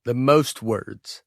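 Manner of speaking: ordinary talk, nothing marked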